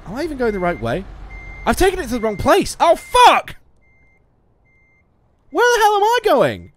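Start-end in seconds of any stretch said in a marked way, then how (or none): 1.6-6.7 high, loud swearing outburst; exasperated yell. Real, not acted. Gamer shouts at his own wrong turn, loud and high. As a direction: let the frustration burst out.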